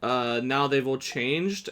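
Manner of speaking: normal talking voice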